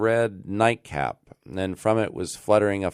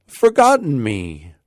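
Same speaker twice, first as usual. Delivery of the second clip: sighing; 8 semitones higher, 8 dB louder than usual. Acted. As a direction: let the hurt swell out louder. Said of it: "sighed Rip".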